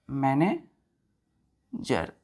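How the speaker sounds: Manager drawn out very slowly; teacher dictating manager slowly; slow drill. Shown as a demonstration. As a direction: stretch manager for the class. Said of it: "drill word slowly".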